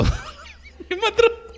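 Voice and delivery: very high, drawn-out, giggly "मत रो"; mock pleading. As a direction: coo it like a sugary comforter, laughing. Mocking the hoped-for consolation.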